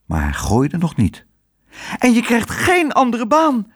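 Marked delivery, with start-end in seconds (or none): 2.0-3.7 warning. A narrator's reading. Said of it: "waarschuwde Otje".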